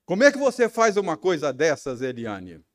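loud, fast, high accusing shout; reenacting the scene, shouting at his wife; angry shouted question. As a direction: yell it at her as if she's right there. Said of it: "Ó, imagine a cena".

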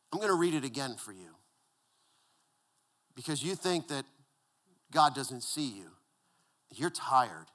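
soft, low, tender reassurance; hushed pastoral comfort; warm. Real, not acted. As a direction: speak gently to someone worn out. Softened pastoral aside to the tired.

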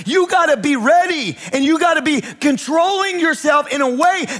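high, driving preacher's exhortation; impassioned preaching. Real, not acted. Pastor urging congregation to be emotionally ready, impassioned preaching. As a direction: preach it urgently, pitched well up.

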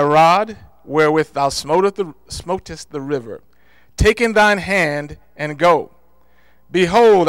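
loud, ringing scripture reading; preaching scripture. Acted. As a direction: read it out like a proclamation from the pulpit. Reading Exodus aloud in sermon.

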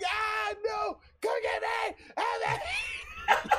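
high, loud, desperate yelling for help; panicked cry for help. Retold or imitated. Kid at the wall begs uncle to save him from gator, high panicked shouting. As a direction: scream for your uncle to pull you up. Fleeing alligator, calling for help.

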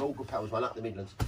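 ordinary talk

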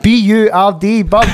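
deliberate letters, then the word; retold spelling out. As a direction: spell it out emphatically for a confused visitor. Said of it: "I'm like".

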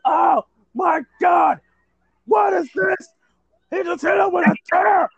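high, loud mock-horrified cry; commentator's hysterical shout. Commentator reacting to a chair shot, hysterical shout. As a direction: overblown shouting with a wailing finish.

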